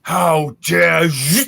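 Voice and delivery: slow, loud outraged roar; outraged dragon. Acted. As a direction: drag out the fury. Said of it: "he's like".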